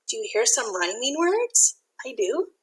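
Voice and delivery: breathy aside whisper. Real, not acted.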